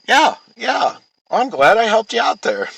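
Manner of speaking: imitating the wife, high and pleased with herself; a smug, high know-it-all voice; smug mock helpfulness. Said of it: "she says it like it's just".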